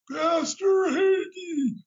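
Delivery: high, loud, drawn-out mimicry; Yitzhak Rabin. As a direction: stretch the name out in a shouted, high-pitched imitation. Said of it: "Yitzhak had that kind of voice".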